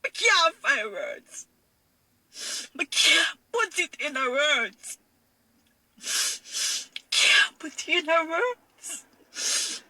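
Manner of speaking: real tearful sobbing, pitch pushed up; words dragged out slowly through tears; crying. Real, not acted. Mother struggling to describe her pain, weeping.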